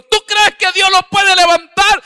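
high, very loud, slow challenge; fervent shout. Retold or imitated. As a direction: shout the question with faith. Pastor challenging the mother.

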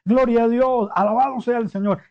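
loud, high cry of praise; high loud glorifying cry; shouting praise. Retold or imitated. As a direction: shout your thanks to God. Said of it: "viene gritando".

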